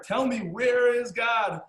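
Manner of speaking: put-on a rabbi raising his voice to a scared boy, slowly. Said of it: "the rabbi says even louder".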